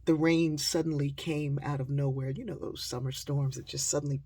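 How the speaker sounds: usual speaking voice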